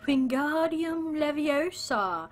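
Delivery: high, loud, stretched-out incantation; theatrical spell-casting. Acted. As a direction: cast the spell with drawn-out grandeur. Acting out Harry Potter spell scene.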